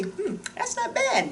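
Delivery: high, slow, soft mock tasting; pleasantly surprised taster. Voicing a viewer trying olives, high surprised taste. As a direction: play a taster warming up to it. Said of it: "Try 'em out and say".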